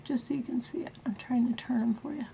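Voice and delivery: soft whispered aside; whispering. Real, not acted. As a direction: whisper while turning them. Quiet aside while turning brushes.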